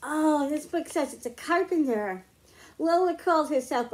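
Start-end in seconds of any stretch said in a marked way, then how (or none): none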